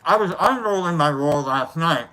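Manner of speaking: talking while chewing; mouth full. Real, not acted. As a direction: speak through a mouthful. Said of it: "talking with his mouth full".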